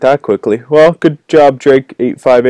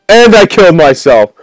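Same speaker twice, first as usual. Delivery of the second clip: loud, high triumphant call; triumphant shout; 6 semitones higher, 5 dB louder than usual. Real, not acted. Gamer winning by dying, triumphant shout. Celebrating a self-kill win.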